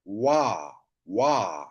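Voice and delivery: "wa" with a w, low and drawn out; pronounced wa. Shown as a demonstration. Teacher shows the new reading, long low "wa". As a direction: say "wa" slowly, pitch falling low. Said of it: "it is pronounced as".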